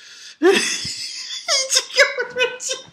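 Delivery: shrieking cackle; real laughter; high squealing laugh. Real, not acted. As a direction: burst out squealing. Laughing at the scene.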